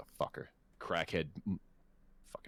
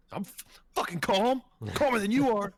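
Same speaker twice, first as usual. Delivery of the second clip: higher, louder indignant snap; mock heated retort; 12 semitones higher, 4 dB louder than usual. To his boss who told him calm down, a higher, louder indignant snap. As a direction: fire back at the boss, insisting you're calm.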